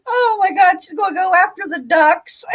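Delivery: high, laughing disbelief. Real, not acted. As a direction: squeal it through giggles.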